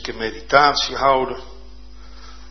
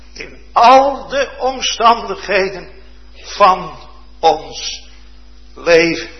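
much louder and higher, fervent preacher; preaching at full force; 6 semitones higher, 6 dB louder, slower than usual. Acted. Preacher stirring the congregation with the blind man's cry, loud and higher. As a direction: thunder it out, building in passion.

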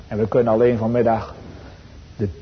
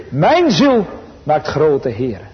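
slow exalted quotation; 4 semitones higher, 3 dB louder, much slower than usual. Acted. Climactic quote of Maria's song.

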